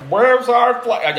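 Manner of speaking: high, loud, drawn-out mock American; badly attempted American accent, slow and booming. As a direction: have a go at an indignant American. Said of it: "I, I can't do the accent".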